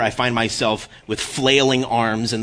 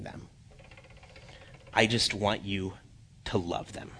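soft, hushed, earnest appeal; quiet, solemn entreaty in God's words; tender plea; 2 semitones lower, 8 dB quieter than usual. Acted. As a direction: plead gently, leaving space between phrases. Voices God's plea to congregation.

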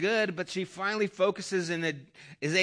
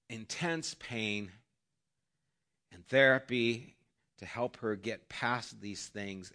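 usual speaking voice

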